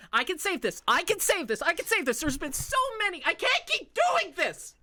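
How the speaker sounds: loud, high, frantic insistence; frantic desperation. Acted. Elf maker in her workshop, loud frantic desperation.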